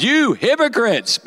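high, drawn-out accusation; hammed-up, high-pitched rebuke; dramatic rebuke. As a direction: ring it out slowly as a preacher's denunciation. Acting Jesus's rebuke to Pharisees.

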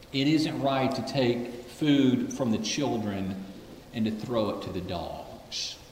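slow, low, soft and sincere; sincere compassion. Retold or imitated. Jesus answering the pleading woman, slow and sincere. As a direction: say it gently, with all sincerity. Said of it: "full of compassion, with all sincerity".

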